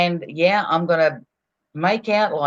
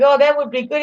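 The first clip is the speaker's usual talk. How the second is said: raised, amused imitation of the shopkeeper; quoting the fruit and veg guy, higher and tickled; imitating the grocer; 8 semitones higher than usual. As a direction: play the fruit seller chuckling along. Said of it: "he's laughing and he's going".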